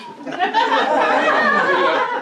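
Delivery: loud, high burst of real laughter; big laugh. Real, not acted. Cracking up at his own story before the congregation, big laugh. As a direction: let out a big belly laugh.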